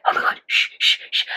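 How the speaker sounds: re-enacted hushed panic with shushing; panicked whisper. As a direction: whisper so they won't hear through the door. Hiding in bathroom from friends.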